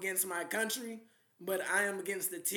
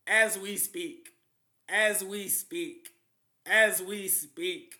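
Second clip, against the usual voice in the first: slow, louder, each word weighed; a slow, portentous warning; slow ominous emphasis; 5 dB louder, slower than usual. Real, not acted. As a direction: lean on it, drawn out and heavy. Ominous repetition of claim.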